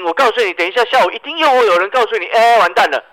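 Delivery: excited prediction. Acted. Mocking panicked investors.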